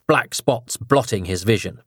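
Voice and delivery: plain reading voice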